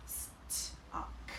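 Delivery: Stuck whispered slowly to hear the t; soft stretched whispered Stuck; whispered sounding out. Shown as a demonstration. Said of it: "It's the t sound".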